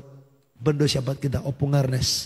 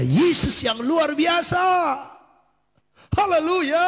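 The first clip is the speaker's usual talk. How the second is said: soaring, high-pitched praise cry; triumphant preacher's shout, stretched at the end; exultant shout; 12 semitones higher, slower than usual. Real, not acted. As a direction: proclaim it to the whole congregation at full height. Climax of praise to congregation.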